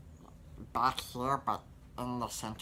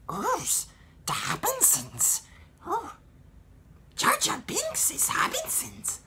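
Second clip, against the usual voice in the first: much higher, louder squeaky alien; Jar Jar Binks; 5 dB louder than usual. Shown as a demonstration. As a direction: squeal it up high and silly. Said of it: "as I'll show now".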